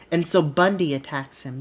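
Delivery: usual speaking voice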